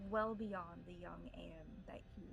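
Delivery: ordinary talk, nothing marked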